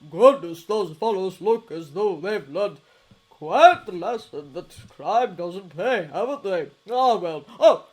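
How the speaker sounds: loud, hearty hero voice with big bursts; Toshinori's booming voice. A narrator's reading. Hero texting his partner, read out loud and hearty. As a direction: boom it out like a cheerful giant.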